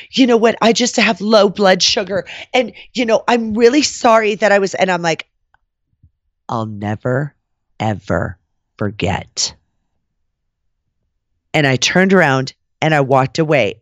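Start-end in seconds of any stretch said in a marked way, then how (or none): 0.1-4.7 higher-pitched mimicked excuse; imitated apologetic diva. 6.5-9.5 low, halting threat with pauses; retold cold menace. Cold threat ending the quarrel.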